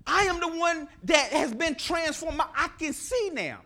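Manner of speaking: the healed blind man, high, loud and overjoyed; a healed man insisting he can see, laughing; joyful insistence. Acted. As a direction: proclaim it with a laugh of joy. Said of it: "the blind man with his eyes open saying".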